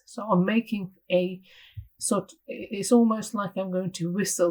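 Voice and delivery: normal talking voice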